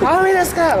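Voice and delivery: high sung-out vendor cry; street vendor call. Retold or imitated. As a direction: belt it like a street seller. Vendor call retold again.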